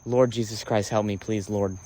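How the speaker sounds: low, hushed plea to God; soft, low murmur; muttered prayer. Real, not acted. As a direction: pray quietly under your breath.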